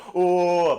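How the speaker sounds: high, loud mimicked "ohhh"; mocking drawn-out "oh"; imitated barber noises. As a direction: mimic a long wordless ooh. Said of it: "he makes sounds at me".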